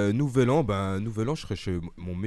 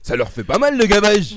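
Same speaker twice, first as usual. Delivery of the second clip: mock deputy shout; 10 semitones higher, 10 dB louder than usual. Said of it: "la vidéo avec les députés là où ils disent".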